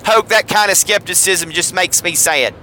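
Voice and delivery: ordinary talk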